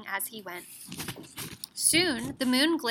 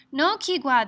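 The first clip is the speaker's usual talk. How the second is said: ordinary delivery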